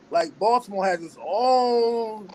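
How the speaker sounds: ordinary delivery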